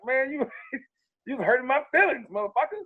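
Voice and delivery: high, whiny hurt-feelings plea; mock hurt whine. To Mr. Gregory after being cussed out, mock wounded whine. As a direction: play the wounded kid, half joking. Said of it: "I damn near cried".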